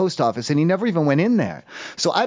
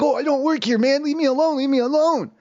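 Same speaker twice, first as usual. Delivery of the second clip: higher mock voice of a panicky stranger; mimicking the man; 8 semitones higher than usual. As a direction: squeak it out like a man shooing her away. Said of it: "he's like".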